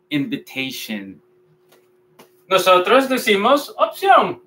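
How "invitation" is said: "Invitation" as "in-vi-TEI-shen", softer and lower; correct English pronunciation. Shown as a demonstration. Teacher repeats the model for learners, correctly pronounced. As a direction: repeat "Invitation" softly, ending in short "shen". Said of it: "vamos a decir invitation".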